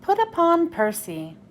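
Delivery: ordinary delivery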